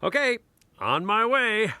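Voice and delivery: high, sung-out send-off; brisk, high-pitched leaving call; announcing departure. Acted. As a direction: call it out high as you head off. Heading out the door.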